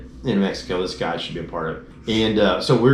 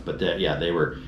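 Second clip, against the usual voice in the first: ordinary talk, nothing marked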